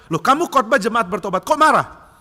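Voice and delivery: loud, high baffled challenge; acted disbelief rising to a shout; incredulous. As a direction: demand to know, astonished. Incredulous questioner challenges angry preacher.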